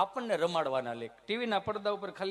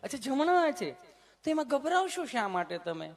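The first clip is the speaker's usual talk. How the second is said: high, sweet nurse voice; mock sugary nurse voice; 9 semitones higher than usual. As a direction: coo it like the kind nurse. Nurse voice continues.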